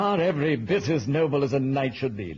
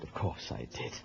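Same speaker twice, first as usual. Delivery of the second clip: whispered aside; 9 semitones lower, 11 dB quieter than usual. Acted. Flustered king, aside.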